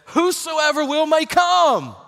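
proclaiming. Acted. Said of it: "The Bible says".

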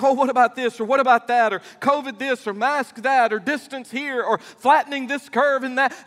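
hammed-up anxious listing; mock fretting. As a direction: rattle off the worries in a mock panic. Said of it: "when I worry and fret and think".

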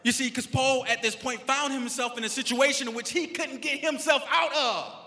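loud, punchy preacher with stretched key words; full-force preaching. Real, not acted. Pastor telling sufferers there is a purpose, loud preaching. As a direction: drive each phrase home to the congregation.

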